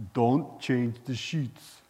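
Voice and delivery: slow, deliberate impression of an old-time preacher; put-on slow, weighty command; Smith Wigglesworth. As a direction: draw out each word in imitation. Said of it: "That's how he talked".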